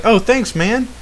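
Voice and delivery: high-pitched mock thank-you; mock gratitude. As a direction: imitate someone sweetly grateful. Voices friend thanking for gifts.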